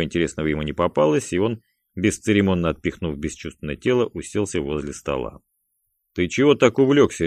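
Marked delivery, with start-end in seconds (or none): 6.1-7.3 louder, higher nosy challenge; jealous, pointed question. A narrator's reading. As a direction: demand jealously to be let in. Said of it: "ревниво спросил он Гарика".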